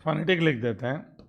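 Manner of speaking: ordinary talk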